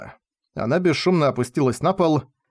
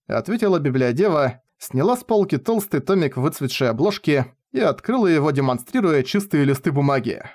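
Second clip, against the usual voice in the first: ordinary delivery, nothing marked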